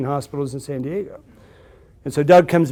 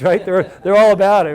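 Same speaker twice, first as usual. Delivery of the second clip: normal talking voice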